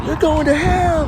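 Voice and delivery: very high, drawn-out damning cry; outraged condemner. Acted. Outraged onlooker damning someone, high-pitched condemnation. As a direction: condemn them in a high, slow put-on voice.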